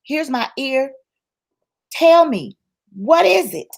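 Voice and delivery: high, insistent questioning; exasperated high-pitched appeal to God; pleading with God. Acted. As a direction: plead upward for an answer. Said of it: "you're at the point like, God".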